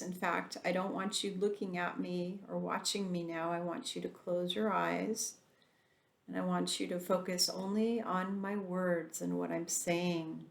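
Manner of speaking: usual speaking voice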